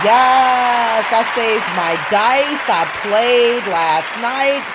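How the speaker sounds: loud, slow victory cheer; triumphant. Real, not acted. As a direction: celebrate the win. Celebrating new shield.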